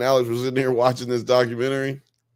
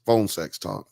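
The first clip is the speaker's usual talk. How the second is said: normal talking voice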